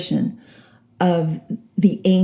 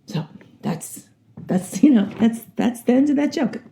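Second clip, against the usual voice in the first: normal talking voice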